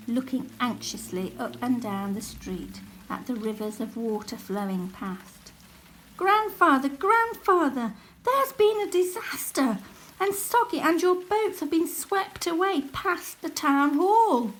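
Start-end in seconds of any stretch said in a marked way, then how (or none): 6.2-14.5 high, loud urgent calling out; alarmed call. Acted. Jack calling Grandfather about the lost boats, high loud alarm.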